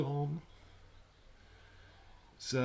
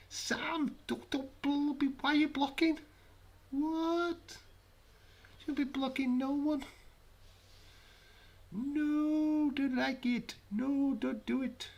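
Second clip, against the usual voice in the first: much higher put-on whiny voice; mock pleading. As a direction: squeak like a mate begging not to be blocked. Pleading not to be blocked.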